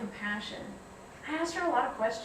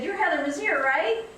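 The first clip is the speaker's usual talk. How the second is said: bright, eager, higher and louder recognition; super excited; 6 semitones higher, 6 dB louder than usual. Retold or imitated. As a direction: greet her like a thrilled fan. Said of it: "super excited".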